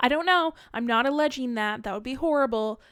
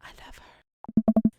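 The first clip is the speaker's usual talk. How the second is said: slow hushed whisper; slow whispered aside of admiration; much slower than usual. Acted. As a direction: breathe it out slowly, smitten. Acted aside about Mary.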